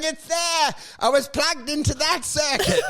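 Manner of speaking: high-pitched hammed-up hysteria; mock hysterical yelling. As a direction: play a hysterical runaway robot, high-voiced. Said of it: "it's yelling hysterically".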